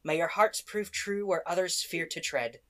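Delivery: a hint of a solemn elf; lightly voiced, stately blessing; wise elf blessing. Elf's true voice blessing.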